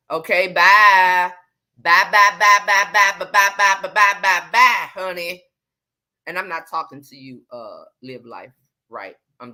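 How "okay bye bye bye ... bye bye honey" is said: loud, rapid-fire string of byes; dismissive send-off. Real, not acted. Host telling critical viewers to go, loud and dismissive. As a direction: shoo them off loudly, bye after bye.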